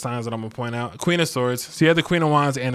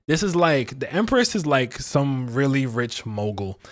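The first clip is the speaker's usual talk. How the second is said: normal talking voice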